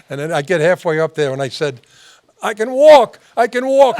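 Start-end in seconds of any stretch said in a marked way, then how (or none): 2.4-4.0 loud, high cry of a healed man; hammed-up faith-healing yell; miracle shout. Faking miracle healing in store.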